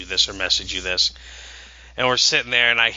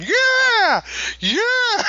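high-pitched, drawn-out celebratory yeah; mock cheering. Proud dad cheering on his son, mock high cheer. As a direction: cheer in a squealed, stretched-out voice.

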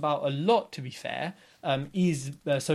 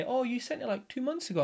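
lightly voiced client, pitched higher; voiced letter recipient; 8 semitones higher, 3 dB quieter than usual. Seller reacting to an old letter, lightly imitated in a higher voice.

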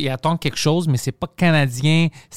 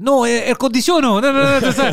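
high, loud imitated Salvadoran French; hammed-up Salvadoran accent; 10 semitones higher, 5 dB louder than usual. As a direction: mimic a Salvadoran struggling in French. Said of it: "avec un accent El Salvadorien".

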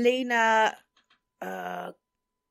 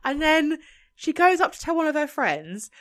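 ordinary talk, nothing marked

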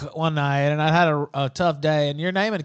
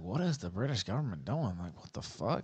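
muttered disbelief; 6 semitones lower, 10 dB quieter than usual. Retold or imitated. Exasperated inner voice.

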